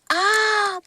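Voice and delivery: high, loud cry of surprise; a sudden gasp of joy at the gift; delighted cry. Real, not acted. Spots the die, cries out. (cri de surprise ravi)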